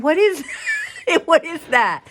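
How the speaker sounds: high, loud startled cry through laughter; mock startled. Viewer staring up a nose, loud startled laughing squeal. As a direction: play the shocked viewer, laughing. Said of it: "you're just like".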